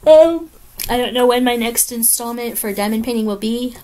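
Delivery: ordinary delivery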